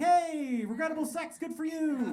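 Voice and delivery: hammed-up congratulations; mock cheering. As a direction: cheer her on, half joking. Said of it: "I was like".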